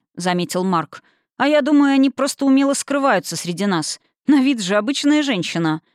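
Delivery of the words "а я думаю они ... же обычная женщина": lightly voiced student, higher and animated; unafraid, opinionated student. A narrator's reading. Student guessing about hidden succubi, lightly animated. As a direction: lift the pitch for an eager young speculator. Student Mark argues his view.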